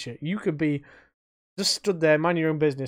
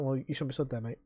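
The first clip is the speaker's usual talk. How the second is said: passive aggressive; 3 semitones lower, 10 dB quieter than usual. Retold or imitated. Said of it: "very passive aggressively".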